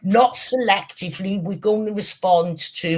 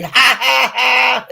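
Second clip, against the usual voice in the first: loud mock "ha ha ha", higher and louder; mocking shouted laugh; 7 semitones higher, 7 dB louder, slower than usual. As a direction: bark out a jeering laugh at him. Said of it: "And they're going".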